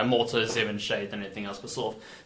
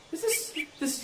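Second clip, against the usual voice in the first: much higher, wondering character voice; curious Roar voice; 7 dB quieter than usual. Acted. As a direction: peer at the toy in a high curious voice. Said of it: "she sort of looks down and is like".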